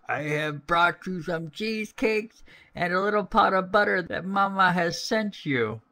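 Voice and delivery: high, put-on girlish voice; wolf imitating little girl. A narrator's reading. Wolf fooling grandmother with cheesecakes, in a false girlish voice. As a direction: pitch it up like a pretend little girl. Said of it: "speaking like a little girl as well as he could".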